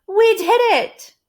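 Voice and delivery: high, loud joyful cheer. Acted. Amelia Bedelia celebrating the tent, high and loud. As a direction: cheer with delight. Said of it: "said Amelia Bedelia".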